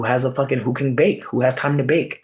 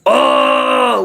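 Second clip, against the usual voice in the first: loud high drawn-out oh; big loud exclamation; exclaiming oh; 12 semitones higher, 7 dB louder than usual. Real, not acted. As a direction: cry out in disbelief. Exclaimed reaction.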